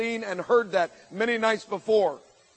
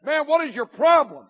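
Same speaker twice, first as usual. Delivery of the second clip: louder, higher challenge over a crowd; yelling across; 6 semitones higher, 6 dB louder than usual. Retold or imitated. Speaker to a cursing senior across the gym, yelling. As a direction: call it out to someone far off, confronting. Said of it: "I yelled across the sea of students".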